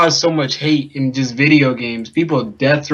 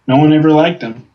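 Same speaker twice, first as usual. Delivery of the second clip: ordinary talk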